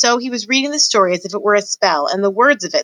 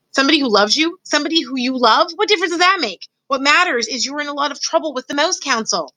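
higher, exasperated little mouse scolding; a put-upon mouse fretting at his brother; fussy mouse brother Furlough; 8 semitones higher than usual. Acted. Exasperated brother mouse scolding Despero, higher.

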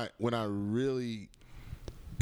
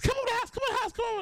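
Chris Tucker impression; much faster than usual. Retelling Chris calling him over.

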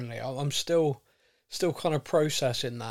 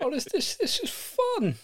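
high, laughing surprised joy; delighted surprise; 13 semitones higher than usual. Retold or imitated. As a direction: let the delight burst out, much higher. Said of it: "fan's surprised delight".

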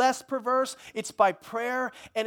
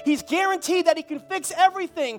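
high, loud, emphatic declaration; defiant preaching; 6 semitones higher, 6 dB louder than usual. Acted. Tenant tells old landlord he's under new management, defiant. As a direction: stand up to the bully with full pulpit force.

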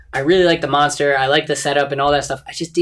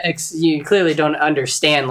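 ordinary talk

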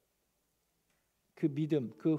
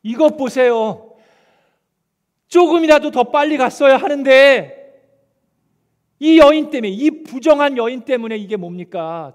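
angry complaint; 7 semitones higher, 14 dB louder than usual. Pretend. Imagined father yelling at Jesus over his dying daughter, furious shout. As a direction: shout your frustration at the delay. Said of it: "엄청 화가 났을 것 같습니다".

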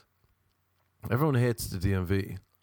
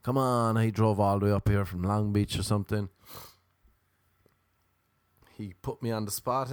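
ordinary talk

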